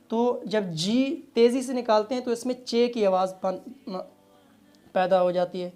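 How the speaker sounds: ordinary talk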